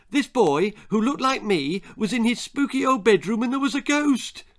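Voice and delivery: put-on high, loud, eager child's voice; excited child. A narrator's reading. Child guessing grandma's ghost story aloud, excited.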